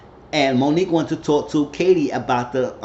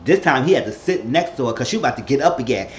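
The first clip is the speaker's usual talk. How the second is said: usual speaking voice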